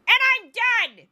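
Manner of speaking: high, loud mock scream; hammed-up screaming rage; Mark Levine. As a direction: yell it like a furious radio ranter. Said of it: "That's my Mark Levine".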